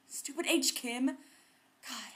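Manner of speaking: usual speaking voice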